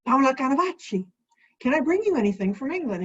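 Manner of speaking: lightly voiced Italian greeting, a bit fast; mock Italian flourish. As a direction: sing the Italian name then rush the question. [name] calls Pam on phone.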